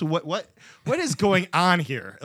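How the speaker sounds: hammed-up confused anger; mock indignant. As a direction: play the baffled customer getting heated. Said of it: "You're getting indignant".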